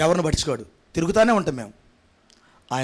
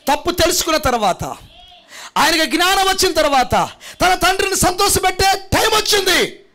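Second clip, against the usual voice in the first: rising, high, loud preaching build; full-force preaching; 11 semitones higher, 3 dB louder than usual. Acted. Pastor rousing the congregation, building to a shouting preach. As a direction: preach it higher and louder, line by line.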